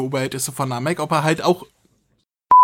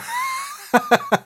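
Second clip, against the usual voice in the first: high squeaky laugh. Real, not acted.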